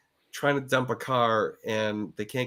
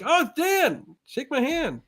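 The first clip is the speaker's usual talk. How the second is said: eager fan; slower than usual. Retold or imitated. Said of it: "There's a lot of people are like".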